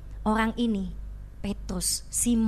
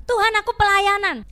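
louder and higher, proudly declared; boastful claim; 9 semitones higher, 10 dB louder than usual. Pretend. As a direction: announce your service like a boast. Believer boasting of ministry.

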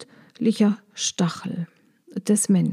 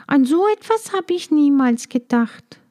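little bee voice; 6 semitones higher than usual. Acted. Maya answers the dragonfly.

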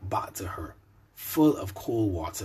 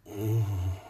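soft moan. A narrator's reading. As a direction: hum a soft pleasured moan. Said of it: "she moaned".

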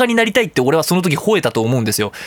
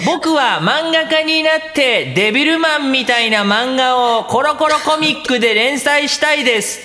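put-on child declaring dream; 7 semitones higher, slower than usual. Child shouting dream at ceremony.

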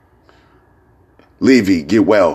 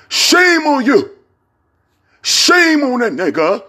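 very high, slow sing-song shame; mock scolding call; 3 dB louder, slower than usual.

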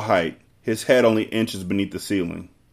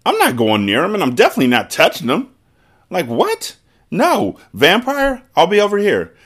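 high panicked refusal; 10 semitones higher, 3 dB louder than usual. Real, not acted. Panicked refusal to approach vampire.

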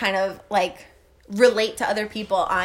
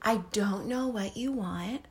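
imitated slow, soft, patient voice; calm partner; 7 dB quieter, slower than usual. Carly soothing a screaming child, calm and soft. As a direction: stay unruffled, quiet and unhurried. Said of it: "she's very calm with her".

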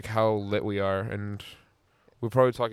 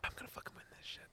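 fast breathless whisper under the breath; quick whisper; 24 dB quieter, faster than usual. Real, not acted. Muttering a boast to himself in a quick whisper. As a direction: hiss it fast, barely voiced.

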